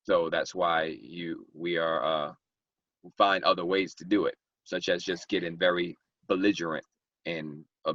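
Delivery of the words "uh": normal talking voice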